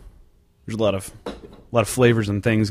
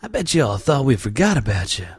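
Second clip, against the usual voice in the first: usual speaking voice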